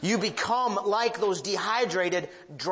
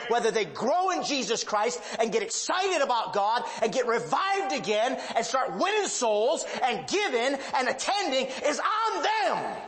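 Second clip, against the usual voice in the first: preaching shout; 8 semitones higher than usual. Real, not acted. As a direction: build it up and drive it home from the pulpit. Pastor builds preaching to climax.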